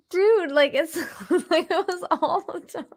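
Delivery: high-pitched laughing exclamation; laughing disbelief. Real, not acted. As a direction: laugh through the words.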